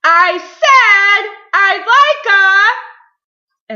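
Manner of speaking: high, slow, huge yell; top-of-voice shout. A narrator's reading. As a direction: bellow it, then break off suddenly. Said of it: "at the very top of his voice".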